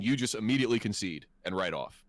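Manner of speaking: normal talking voice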